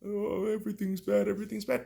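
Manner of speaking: lightly voiced complaining pest; nagging whine. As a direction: imitate a nagging pest moaning "everything's bad". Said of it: "that fucking pest just nagging at you".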